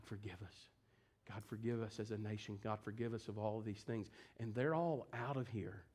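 low, soft, grave sermon delivery; subdued, prayerful preaching; hushed solemn preaching. Real, not acted. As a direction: drop down low and quiet, pleading with the congregation.

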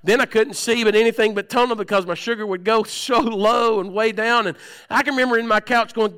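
loud, high, fervent preaching, pushing every phrase; full-force preaching. Acted. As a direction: preach it out at full power to the congregation.

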